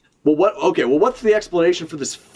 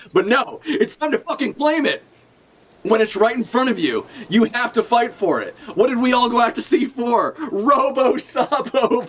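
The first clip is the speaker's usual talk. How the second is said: high-pitched hammed-up battle speech; rally the bros as if heading out to sea; impassioned plea pitched high, playfully overblown; mock rallying cry; 8 semitones higher than usual.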